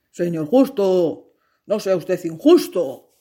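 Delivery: understated begging; pleading. Acted. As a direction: beg the master to be fair. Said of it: "suplicó el espantapájaros".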